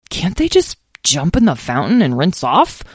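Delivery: plain reading voice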